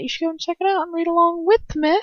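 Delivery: sing-song invitation to read along; high playful plug. Acted. Reader urging listeners to check the fic, high and playful. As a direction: pitch it way up, bright and teasing.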